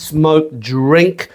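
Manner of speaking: normal talking voice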